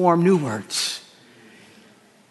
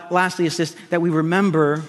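ordinary talk, nothing marked

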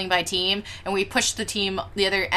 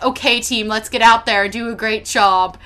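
mock matter-of-fact; 3 semitones higher, 7 dB louder than usual. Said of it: "so matter-of-factly".

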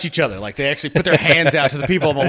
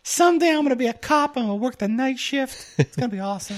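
high squeaky voice; helium voice; 10 semitones higher, faster than usual. Pretend. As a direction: squeak it like you inhaled helium. Little Doug voice continues.

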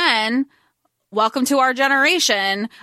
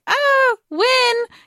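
mock sister; 9 semitones higher than usual. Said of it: "his sister's like".

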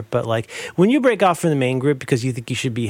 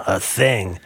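ordinary talk, nothing marked

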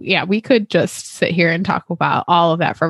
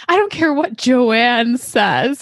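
higher, drawn-out mock indignation; hammed-up insistence, pitched up; indignant declaration; 7 semitones higher, slower than usual. Host quoting Henry defending a fan theory, mock indignant.

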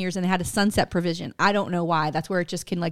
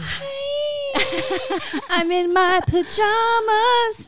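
high squealing mock greeting; silly squeaky hi; 13 semitones higher, much slower than usual. Host greeting listeners in a high silly squeal.

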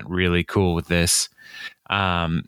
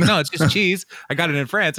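mock nervous excuse; 8 semitones higher than usual. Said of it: "he's like".

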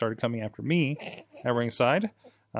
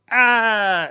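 high shrugging ah; 9 semitones higher, 7 dB louder than usual. Retold or imitated. Ref acting bribed.

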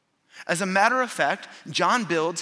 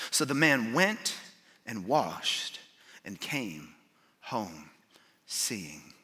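slowed-down scripture reading; 6 semitones lower, 5 dB quieter, much slower than usual. Real, not acted. Preacher reads the blind man's healing to the congregation, slowed and fading. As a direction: read slowly, sinking low and soft. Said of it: "I wanna slow down on verse six".

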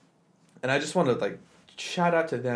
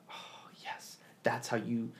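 hushed, amazed whisper; whispered admiration; awed whisper; 11 dB quieter, slower than usual. Real, not acted. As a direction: breathe it out in awe. Her own awed thought, whispered.

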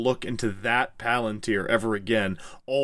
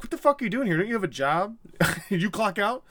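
higher, lightly voiced outraged wizard; mock indignant Gandalf; 8 semitones higher, faster than usual. Host mocking Gandalf scolding Pippin, higher mock outrage. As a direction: play Gandalf scolding, half joking. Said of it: "and Gandalf's like".

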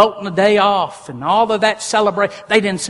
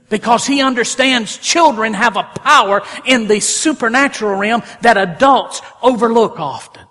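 loud, driving preacher voice; preaching at full force; 4 semitones higher, 5 dB louder than usual. Acted. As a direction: preach it hard to the congregation.

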